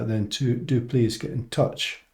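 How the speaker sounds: usual speaking voice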